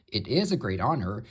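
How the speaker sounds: usual speaking voice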